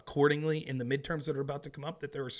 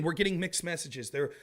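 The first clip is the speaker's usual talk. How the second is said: ordinary talk, nothing marked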